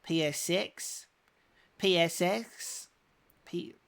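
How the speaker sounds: put-on high, slow New Zealand accent. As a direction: imitate a Kiwi saying "P S six". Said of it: "Now try it in a New Zealand accent".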